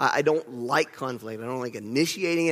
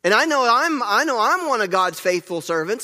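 higher and louder, protesting his own faithfulness; indignant psalmist; 6 semitones higher, 5 dB louder than usual. Retold or imitated. Preacher imitating the psalmist scolding the people, indignant and raised. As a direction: push back, sure you are in the right. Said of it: "He was like".

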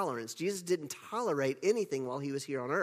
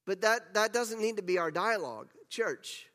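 ordinary delivery

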